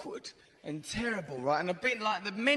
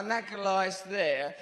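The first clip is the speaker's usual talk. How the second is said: ordinary talk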